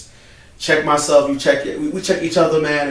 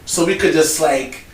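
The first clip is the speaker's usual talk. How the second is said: excited; 7 semitones higher, 10 dB louder than usual. Real, not acted. Excited about bringing a drone.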